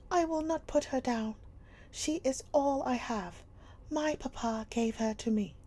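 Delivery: put-on high, loud young girl clinging to her doll; a defiant child refusing to let go; girl's voice. A narrator's reading. Sarah defying Miss Minchin, high and loud.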